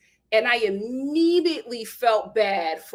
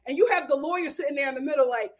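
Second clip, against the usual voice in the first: usual speaking voice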